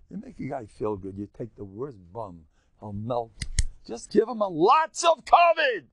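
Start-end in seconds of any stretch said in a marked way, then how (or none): 3.9-5.8 loud, high exhortation; raised preaching voice; exhorting shout. Real, not acted. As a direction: call it out to the crowd.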